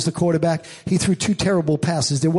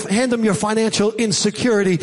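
normal talking voice

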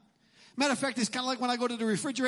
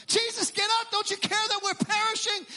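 higher, pleading alarm as a retelling; panicked disciples; 6 semitones higher than usual. Retold or imitated. Disciples waking Jesus in the storm, higher and panicky. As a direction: wake Jesus in mock alarm. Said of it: "They panic".

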